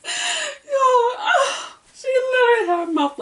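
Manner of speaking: can barely get words out for laughing; laughing hard. Real, not acted. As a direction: voice squeaking high through laughter. Cracking up retelling performance.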